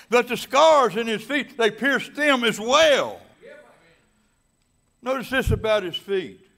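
loud, high, rolling preacher cadence; full-force preaching. Acted. Pastor preaching to his congregation, loud and fervent. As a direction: drive the scripture home to the congregation.

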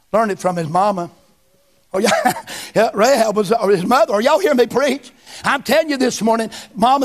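ordinary talk, nothing marked

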